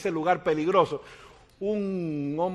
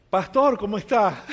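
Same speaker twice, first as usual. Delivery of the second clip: greeting fan; 8 semitones higher, 8 dB louder than usual. Retold or imitated. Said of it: "la gente me paraba en la calle".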